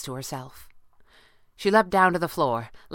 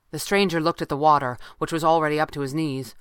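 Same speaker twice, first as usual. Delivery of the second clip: ordinary delivery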